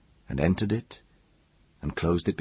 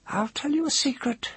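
very high, thin voice; confiding woman. A narrator's reading. Woman offering the mage a secret, high-pitched.

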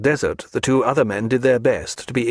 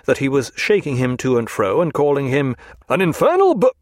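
ordinary delivery

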